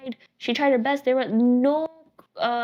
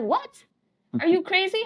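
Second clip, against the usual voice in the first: shocked disbelief; 6 semitones higher than usual. Retold or imitated. Grandparents shocked at father's lie.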